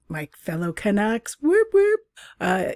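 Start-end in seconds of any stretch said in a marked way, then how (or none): 1.4-2.0 high, loud whoop of delight; bright little celebratory whoop; excited cheer. Real, not acted. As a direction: cheer for your fellow Canucks. Cheering own country listeners.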